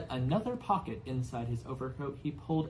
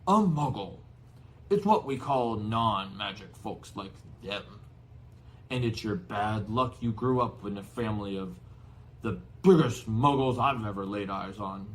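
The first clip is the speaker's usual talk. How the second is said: scornful giant; 2 semitones lower, 5 dB louder than usual. Acted. Hagrid explaining to Harry.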